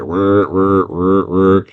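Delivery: slow, loud mechanical threat; whir, then declare it like a giant robot; huge robot. Acted. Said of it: "there's this huge-ass robot".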